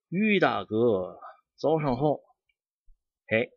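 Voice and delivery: put-on greeting in a Shandong dialect accent, rising on 早上好. Greeting Brother Yu to show off his Shandong dialect, in a Shandong accent. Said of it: "我就说山东话".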